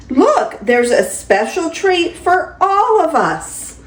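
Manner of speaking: lively, sing-song picture-book reading; grandma reading a bedtime story; reading to children. Acted. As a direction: read it to a small grandchild with delight. Grandma reads picture book aloud.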